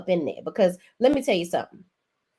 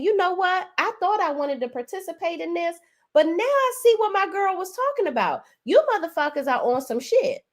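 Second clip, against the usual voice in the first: indignant realization; 8 semitones higher than usual. Retold or imitated. Acted realization of a cast member.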